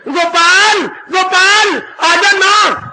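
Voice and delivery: loud high call across the house; mother calling out. Retold or imitated. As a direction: call the child home loudly. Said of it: "माँ आवाज मार रही है". (माँ की ऊँची पुकार)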